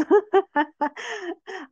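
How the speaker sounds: high, loud laughing fit; real laugh. Real, not acted. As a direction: crack up after the joke. Laughing at own joke.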